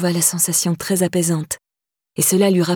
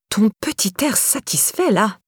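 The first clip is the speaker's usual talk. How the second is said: higher, sneering spit of disgust; spat with disgust; 3 semitones higher than usual. Acted. Stepmother sneering at Laura at breakfast, disgusted. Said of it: "cracha Didre avec dégoût".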